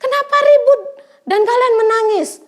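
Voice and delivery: high, loud questioning call; Jesus calling out to the wailing mourners; loud rebuke. Acted. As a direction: call over the noise to stop the crying. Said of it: "Bahkan kata Tuhan Yesus".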